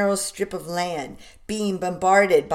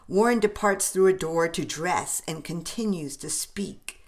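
plain reading voice

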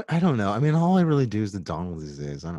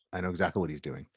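ordinary talk, nothing marked

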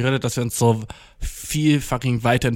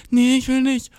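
lightly voiced little sister, higher and whiny; mock little sister; 11 semitones higher than usual. Imitating his little sister refusing the dare, whiny. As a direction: play the reluctant kid sister refusing.

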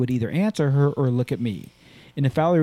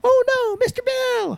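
much higher, cartoonish wail of Oh, no; Mister Bill cry; slower than usual. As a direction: squeal it like the clay cartoon figure. Voicing the Mister Bill catchphrase.

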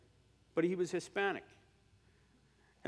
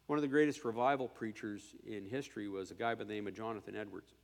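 usual speaking voice

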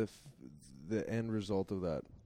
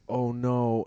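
louder, hammed-up dismay; mock dread; 2 semitones higher, 7 dB louder than usual. Boyfriend caught when girlfriend wakes, mock dread. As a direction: blurt it out in mock alarm.

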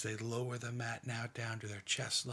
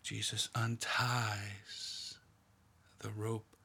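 hushed, half-whispered meditation, leaving long pauses; guided meditation. Acted. Leader guiding a prayerful meditation, in a hushed voice.

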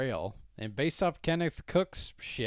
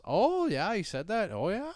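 put-on higher, lilting small-town Minnesota accent; small-town Minnesota chat; 7 semitones higher than usual. Retelling the Fargo police conversation, Minnesota accent. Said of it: "the way the conversation happens".

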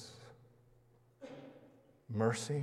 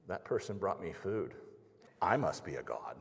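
lower, quieter smug cat; lightly voiced smug cat, muttered low; 5 semitones lower, 9 dB quieter than usual. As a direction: drop the voice low and self-satisfied like a cat. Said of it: "the cat looks at the food and goes".

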